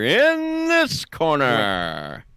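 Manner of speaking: slow, drawn-out ring announcer call; boxing announcer. Retold or imitated. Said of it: "You sound like a boxing announcer".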